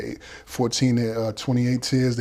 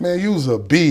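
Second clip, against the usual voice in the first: yelling on the tier; 6 semitones higher, 5 dB louder than usual. Retold or imitated. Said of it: "I'm yelling".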